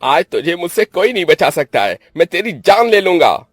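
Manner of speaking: high, loud enraged shouting; roaring murderous rage; furious threat. Acted. Brother threatens to kill sister.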